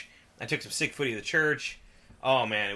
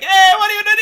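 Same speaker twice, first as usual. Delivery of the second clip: put-on very loud, high Italian-accented shouting; exaggerated Italian accent; 15 dB louder, faster than usual. Imitating locals on arrival in Milan, shouted Italian accent. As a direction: holler it like a hammed-up Italian. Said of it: "exaggerated Italian accent".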